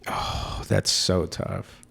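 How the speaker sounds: normal talking voice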